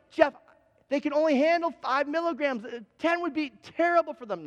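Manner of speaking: mild imitation of an exasperated wife; lightly voiced wife. Wife voiced in retold argument.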